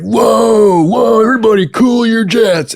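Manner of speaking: high, loud mock shout of whoa; boss calming everyone. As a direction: throw up your hands and shout them down as the boss. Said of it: "And he was like".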